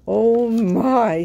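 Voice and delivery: high startled oh my; dismayed exclamation. Acted. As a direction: gasp it out in dismay. Dismay at the delay.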